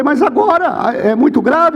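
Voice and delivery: high, urgent disbelief; acted high-pitched startled questions; alarmed questioning. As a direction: react anxiously to urgent news on the phone. Retold alarmed early phone call.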